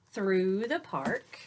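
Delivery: ordinary delivery, nothing marked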